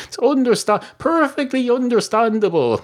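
high-pitched, acted allowance; emphatic concession. [name] concedes Joseph's limited patience.

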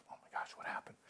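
hushed, breathless whisper of shock; panicked whisper. Acted. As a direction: whisper it like you can't believe it. Boy vanished, panicked aside.

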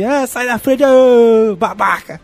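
Wolverine shout. Retold or imitated. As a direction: yell it like the cartoon Wolverine. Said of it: "de falar assim".

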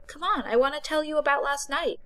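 put-on eager young woman. A narrator's reading. Voices Becca.

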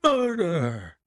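horrified servant exclaiming; shocked butler. Acted. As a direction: blurt it out in horror. Butler announces the crime.

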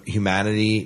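ordinary talk, nothing marked

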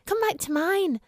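put-on a friendly child inviting her friend home; young girl. A narrator's reading. Narrator voices young friend Suzanne.

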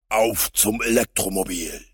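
loud superhero exclamation; rally like a masked hero; heroic call to action. A narrator's reading. Hero leaps into his car. (heldenhafter Ausruf)

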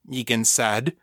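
usual speaking voice

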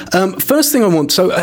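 ordinary delivery

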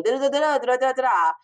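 sing-song placeholder for an announcing headline; mimicked announcement fanfare. Shown as a demonstration. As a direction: trumpet out nonsense syllables.

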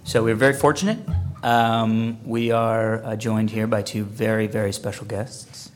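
loud, low announcer voice; host a con panel, booming; panel moderator voice. Acted.

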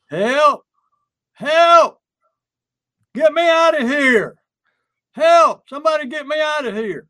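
loud, high, urgent mock cries for help; yelling for help. Someone locked in a car trunk, yelling for help. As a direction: yell out as if trapped. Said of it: "start yelling".

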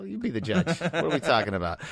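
ordinary talk

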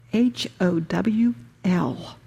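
spelling "H O W L" out slowly, one letter at a time; spelled out letter by letter. Shown as a demonstration. Preacher spelling out "H O W L" slowly for the congregation. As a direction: spell "H O W L" letter by letter, slow and deliberate. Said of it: "your ego is going to howl".

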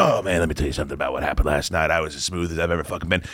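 low, fast bragging bro voice; lightly voiced smug bro; frat bro. Quoting friend's bragging.

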